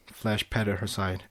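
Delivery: ordinary delivery, nothing marked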